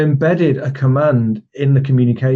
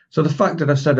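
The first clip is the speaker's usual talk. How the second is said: ordinary talk, nothing marked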